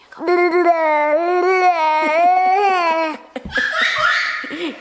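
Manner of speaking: big delighted laugh; loud laughter. Real, not acted. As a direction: burst out laughing. Laughing at child.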